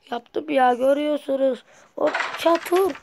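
loud excited bragging; triumphant shout. Real, not acted. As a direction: shout out the win.

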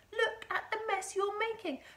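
high, loud grandson's complaint; indignant boy. A narrator's reading. Boy scolds grandma for mess.

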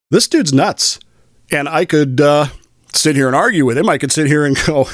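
ordinary delivery

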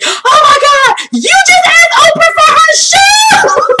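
high, loud, drawn-out shriek of disbelief; piercing retold freak-out; imitated shocked screaming. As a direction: scream it like a stunned sister-in-law. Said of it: "everyone in the audience started screaming".

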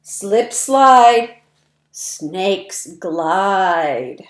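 bouncy, sing-song rhyming read-aloud; reading to children. A narrator's reading. Reading rhymes to young children, lively sing-song. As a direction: punch each rhyme for little listeners.

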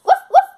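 high loud yappy woof; puppy bark. Acted. The new puppy answers its owner with high yappy barks.

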